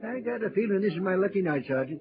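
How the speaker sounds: ordinary delivery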